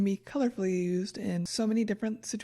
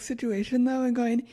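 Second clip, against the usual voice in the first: ordinary delivery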